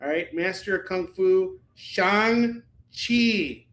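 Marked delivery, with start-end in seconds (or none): 1.8-3.6 "Shang-Chi" as SHAHNG-chee, high and stretched; correct pronunciation. Shown as a demonstration. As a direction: linger on each syllable of "Shang-Chi". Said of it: "You have to say it right".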